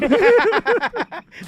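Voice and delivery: high, loud laugh; loud burst of laughter. Real, not acted. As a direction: crack up loudly.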